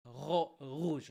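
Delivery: rouge with a throaty French R, after a false start on ro-. Shown as a demonstration. French speaker showing how we say it, French R. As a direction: scrape the R of rouge at the back of the throat. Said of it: "that's how we say it".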